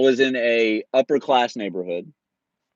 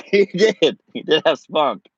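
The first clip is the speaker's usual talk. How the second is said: talking through real laughter, higher and louder; amused laughing; 4 semitones higher, 6 dB louder, slower than usual. Real, not acted. Storyteller tickled by the old man's spunk, laughing. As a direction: laugh as you say it.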